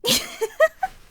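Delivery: a bright, high laugh at her own joke; high giggle. Acted.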